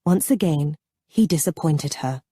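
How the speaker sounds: plain reading voice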